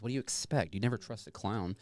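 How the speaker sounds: ordinary talk, nothing marked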